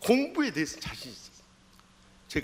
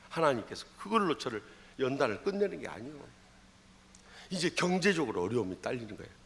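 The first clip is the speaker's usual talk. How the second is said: ordinary talk, nothing marked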